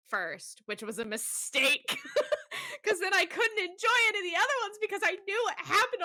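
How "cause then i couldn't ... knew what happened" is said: high fast laughing words. Real, not acted. Laughing through the story.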